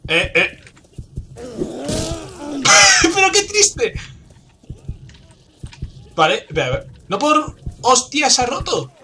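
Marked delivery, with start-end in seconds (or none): 1.4-3.9 very high, squealing laugh into words; laugh, then squeak the words out; helpless laughter. Real, not acted. Gamer laughing at his own sad play, helpless laughter. 7.1-8.9 shocked alarm. Real, not acted. Gamer thinks his weapon just broke, shocked alarm.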